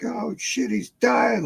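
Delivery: high, breathy gasp of panic; scared kid's high gasp; panicked kids. Retold or imitated. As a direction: blurt it in sudden high-pitched fright. Kids panicking over collapsed uncle.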